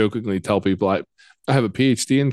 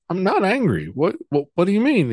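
baffled denial; 8 semitones higher than usual. Retold or imitated. Denying anger to child.

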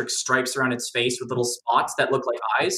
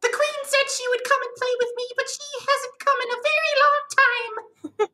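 very high, sweet little-creature voice; a lonely, eager puppy of a caterpillar spirit; squeaky spirit begging a stranger to play; Marmu's voice. Pretend. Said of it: "I imagine Marmu's voice".

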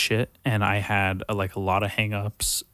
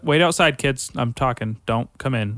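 put-on louder and higher, shooing kids out; barking at the kids; 5 semitones higher, 5 dB louder than usual. Teacher to kids in the hallway, sharp loud call. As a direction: wave the kids off loudly.

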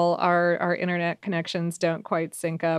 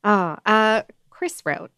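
ordinary delivery

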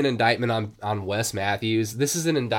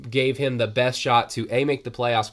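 ordinary talk, nothing marked

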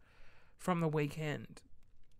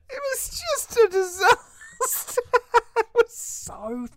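high laughing; 11 dB louder, slower than usual. Real, not acted. Laughing about the disaster.

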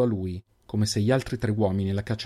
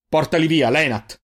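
raised, louder order; angry command; 5 semitones higher, 7 dB louder than usual. Acted. Giofix has the prisoners removed, angry command. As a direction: order the lieutenant to move at once. Chief snaps order at lieutenant.

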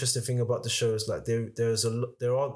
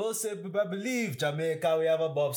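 put-on higher-pitched Cool Runnings Jamaican accent; 5 semitones higher than usual. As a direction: imitate a movie-style Jamaican accent. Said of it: "those Jamaican accents".

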